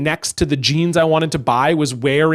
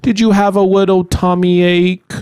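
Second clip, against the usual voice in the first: put-on condescending baby talk; 2 semitones higher, 3 dB louder than usual. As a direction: coo at him like a hurt toddler. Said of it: "People were like".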